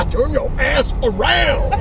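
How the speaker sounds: slow, high mock biker yell; hammed-up biker barking orders; gravelly biker drawl. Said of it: "they should use a biker's voice".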